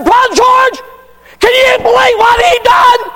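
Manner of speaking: high, loud outraged cry; indignant shout. Retold or imitated. Pastor venting to Brother George about the man, shouting.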